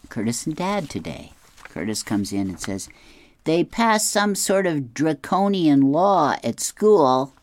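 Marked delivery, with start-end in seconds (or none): 3.4-7.3 high, loud, slow stressed rant; stressed angry kid. Retold or imitated. Boy complaining to dad about phone ban, high stressed whine. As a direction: whine it out like a boy outraged by a school rule. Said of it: "He is so stressed about this and really angry".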